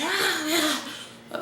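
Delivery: a sudden high, unhinged "ah, yeah"; mock creepy tripping stranger; eerie high voice. Imagined stranger with a knife, high and eerie. Someone tries it, grabs a knife.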